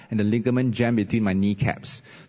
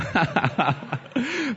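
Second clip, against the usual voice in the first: laugh; 11 semitones higher than usual. Real, not acted. Laughs at his own joke.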